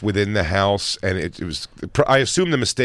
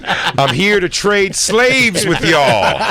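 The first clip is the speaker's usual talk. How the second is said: slow drawling farm trader; 5 semitones higher, much slower than usual. Pretend. Playing farm visitor bit.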